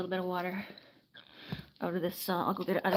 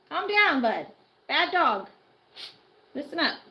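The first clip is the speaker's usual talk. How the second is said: loud, higher scolding; scolding the dog; 5 semitones higher, 8 dB louder, slower than usual. Real, not acted. Owner scolding the barking dog, loud stern command. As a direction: tell the dog off firmly.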